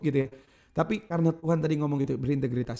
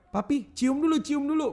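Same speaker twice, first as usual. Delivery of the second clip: put-on higher pleading kid voice, lightly done; imitating kids; 9 semitones higher than usual. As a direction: mimic the children begging for a kiss. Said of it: "anak-anak sebelum pergi bilang". (anak kecil merengek minta cium, ditirukan ringan)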